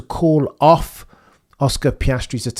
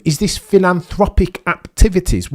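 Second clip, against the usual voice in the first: ordinary talk, nothing marked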